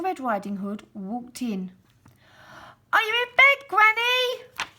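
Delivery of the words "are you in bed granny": put-on high, loud girlish call. Girl calling to granny from the doorway, high and loud. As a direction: call through the house for granny. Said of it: "she called".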